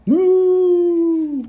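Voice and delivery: high, loud, long moo; weird cow moo. Acted. Storyteller's first try at the cow, weird high moo. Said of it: "That was a weird cow".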